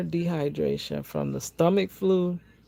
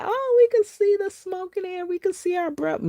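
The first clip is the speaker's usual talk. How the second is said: high, delighted mock gasp at the cold air; excited wonder; faster than usual. Friends marveling at their breath in Chicago cold, excited. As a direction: sound thrilled like a tourist seeing frost. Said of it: "we were like".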